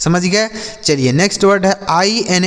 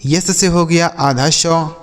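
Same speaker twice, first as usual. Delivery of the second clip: ordinary delivery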